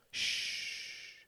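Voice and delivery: soft unvoiced shush; quiet him gently; soothing shh; hush. A narrator's reading. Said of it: "he said".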